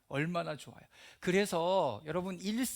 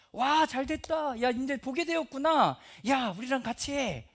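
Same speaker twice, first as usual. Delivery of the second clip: high, louder cheer of congratulation; acted glad welcome; 7 semitones higher, 5 dB louder than usual. Preacher voicing well-wishers to the healed man, acted delight. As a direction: exclaim it high, drawing out the wow.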